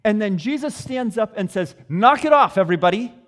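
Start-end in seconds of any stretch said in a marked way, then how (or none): 1.9-3.1 loud, stern fatherly scolding; dad scolding his kids. Acted. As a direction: call the whole boat to order like a father. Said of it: "As though it's a dad talking to his kids".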